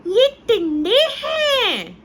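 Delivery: put-on very high, slow, astonished little girl with a laugh; amazed child. Daughter tasting tinda for the first time, delighted astonishment. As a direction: gasp it out in delight like a surprised kid.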